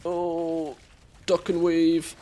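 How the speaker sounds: usual speaking voice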